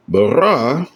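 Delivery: Bara with the stress on the second syllable, ra; Bara, leaning hard on its last syllable; stress on final syllable. Shown as a demonstration. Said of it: "here's the accent".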